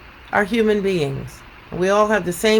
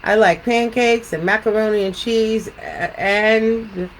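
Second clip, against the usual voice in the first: measured storytelling with lilting questions; picture book read-aloud. Acted. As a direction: read to children, pausing after each thought.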